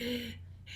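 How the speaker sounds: sharp wheezy gasp for air; asthmatic gasp. Shown as a demonstration. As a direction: gasp in like lungs won't fill. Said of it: "I'm going to sound like I'm out of breath".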